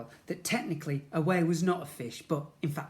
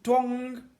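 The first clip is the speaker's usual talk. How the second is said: loud high twong; ping out the spring noise; twanging sound; 9 semitones higher, 7 dB louder than usual. Acted. Sound effect.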